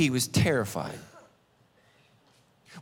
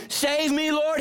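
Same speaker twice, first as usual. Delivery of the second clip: shouted plea; 12 semitones higher, slower than usual. A narrator's reading. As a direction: call out Save me high and urgent. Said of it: "he shouted".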